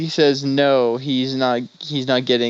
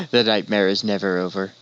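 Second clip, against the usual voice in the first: low, ominous movie trailer narration; horror trailer voice; 4 semitones lower than usual. Pretend. Mock game trailer narration.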